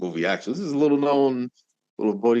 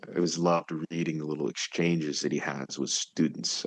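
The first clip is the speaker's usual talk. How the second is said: normal talking voice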